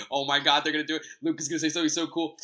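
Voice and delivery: put-on loud, giddy kid at Christmas; excited little kid. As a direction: imitate a kid bursting with anticipation. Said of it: "like a little kid in, uh, in Christmas".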